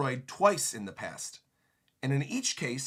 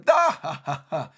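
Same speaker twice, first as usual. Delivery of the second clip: mocking laugh; 6 dB louder than usual. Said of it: "the rest of them are laughing at him".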